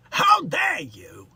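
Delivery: hammed-up Bronx outrage; Bronx vibe. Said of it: "That's more of a Bronx vibe we do".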